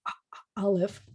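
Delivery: soft ah with a throat catch; quiet glottal ah for Aleph; glottal stop demo. Shown as a demonstration. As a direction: close the throat then release, quiet. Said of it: "like closing your throat and opening it".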